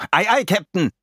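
called back. A narrator's reading. Emilio acknowledges the captain's order, calling back. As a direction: call back keenly to the captain. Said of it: "rief er zurück".

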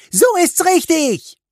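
very high, loud shout of approval; roaring cheer. A narrator's reading. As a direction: yell your approval at full voice. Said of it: "brüllte Fischbein".

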